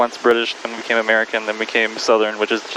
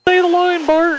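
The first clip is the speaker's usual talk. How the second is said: very high, gleeful quoted cry; mock catchphrase demand; 4 dB louder than usual. Pilot jokes about the awaited radio line, mock begging. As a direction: squeal it like a fan begging for a catchphrase.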